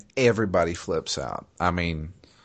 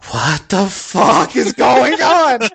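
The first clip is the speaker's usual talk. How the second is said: slow, high, loud outraged yell; outraged shout; 13 semitones higher, 9 dB louder, much slower than usual. Retold or imitated. Grand Cleric yelling at the party in disbelief. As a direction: roar it out in disbelief. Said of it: "he's just like".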